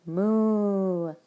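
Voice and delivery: long, drawn-out cow call; stretched mooing demonstration; onomatopoeic moo. Shown as a demonstration. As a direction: imitate a cow lowing with the word. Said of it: "words that sound like that".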